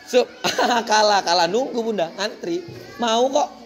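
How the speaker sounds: ordinary talk, nothing marked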